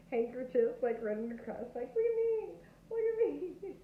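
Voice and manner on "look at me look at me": rodeo clown yelling for attention, much higher and louder; mock rodeo clown call. Rodeo clown calling the bull over, high, loud mock shout. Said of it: "like the clowns with the red".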